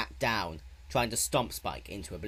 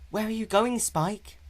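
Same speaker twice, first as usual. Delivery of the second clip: high, sing-song question; creepy child taunt; 13 semitones higher, 4 dB louder than usual. A narrator's reading. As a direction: tease him from the shadows. Nyx taunts from the alley.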